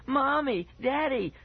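put-on very high, panicked child's call; acted high-pitched scream for rescue. A narrator's reading. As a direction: cry out for parents. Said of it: "I heard him scream".

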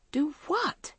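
high, rising, incredulous question; baffled protest. A narrator's reading. Maggie answers an accusation she doesn't understand, baffled. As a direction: ask it high, genuinely puzzled.

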